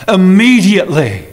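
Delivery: "Immediately" said alone, stretched on the second syllable; emphatic IMMEDIATELY. Shown as a demonstration. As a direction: punch and lengthen "Immediately". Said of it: "This is emphasized".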